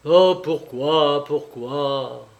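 slow, drawn-out lament; mildly anguished, lingering question; plaintive. A narrator's reading. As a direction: draw out each why like a plea. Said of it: "murmura-t-elle".

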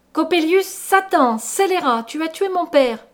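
high, loud denunciation; anguished shout at a killer; accusing cry. A narrator's reading. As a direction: hurl each name as an accusation. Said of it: "m'écriai-je".

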